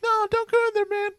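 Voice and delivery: very high-pitched dummy warning; Valentino. Retold or imitated. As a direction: squeal a clear, open warning as the dummy. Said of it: "He has a very high-pitched voice".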